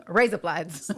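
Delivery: "Raise up lights" run together like Australian "razor blades"; Australian accent demo. Shown as a demonstration. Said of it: "razor blades in an Australian accent".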